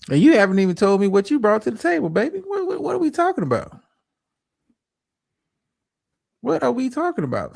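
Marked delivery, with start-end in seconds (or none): 2.4-7.6 high-pitched, exasperated disbelief; real incredulity, pitched way up; incredulous. Real, not acted. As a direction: stammer it out high, baffled by the demand. Scoffing at one-sided demands.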